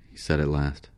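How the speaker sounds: usual speaking voice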